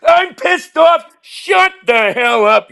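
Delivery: pissed-off high-pitched squawk; hammed-up angry outburst, words stretched out; Bernie Sanders. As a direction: squawk it like a cranky little bird. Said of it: "he got a little pissed off".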